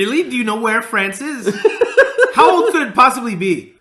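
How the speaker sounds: scoffing at the film with a laugh; incredulous mockery. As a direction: ask it as if it's plainly absurd. Mocking the film's claim.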